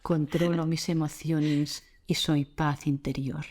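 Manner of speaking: lightly mock a self-controlled master; calm guru voice. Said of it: "Con esta voz de maestro".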